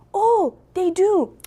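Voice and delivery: high, slow, drawn-out reaction; retold excited realisation. Host answering about dad's cousin, retold with drawn-out excitement. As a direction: stretch it out high with sudden recognition.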